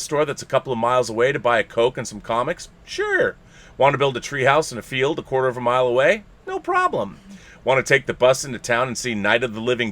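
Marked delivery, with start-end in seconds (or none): 2.8-3.4 put-on squeaky high kid reply; high eager voice. Mock kid answering eagerly. 6.5-7.2 put-on squeaky high kid reply; high eager voice. Mock kid answering eagerly.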